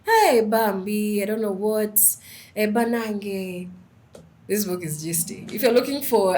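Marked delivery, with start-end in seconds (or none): 0.0-3.7 loud, drawn-out Ugandan exclamations; Ugandan roommate's voice. Retold or imitated. Speaker mimics her Ugandan ex-roommate reading the book, loud Ugandan accent. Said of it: "ex-roommate's voice, 'cause she was Ugandan".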